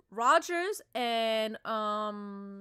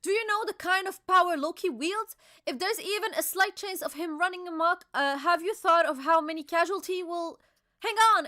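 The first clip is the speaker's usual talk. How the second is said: voiced higher as an alarmed old man; raised, pressing warning; Yasan's voice; 7 semitones higher, 4 dB louder than usual. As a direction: warn them urgently, pitched up. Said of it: "Yasan says".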